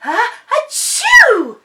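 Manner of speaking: high, loud drawn-out sneeze; ah then achoo, loud and high; big sneeze. A narrator's reading. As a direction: build up and let the sneeze explode. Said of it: "sneezed Dylan".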